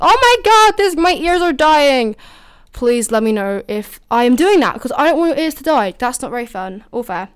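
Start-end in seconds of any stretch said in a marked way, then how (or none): 0.0-2.1 higher, louder, hammed-up pained cry; mock listener complaint. Podcaster imagining listeners whining about loud music, mock pained. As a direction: play a listener whose ears hurt. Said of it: "people's ears are going like".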